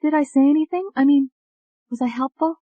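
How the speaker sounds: high, tentative questioning voice; anxious young woman. A narrator's reading. Ashley asks David if she helped, high anxious voice. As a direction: ask timidly, high, with hesitant gaps.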